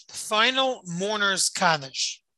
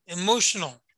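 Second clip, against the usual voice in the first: ordinary talk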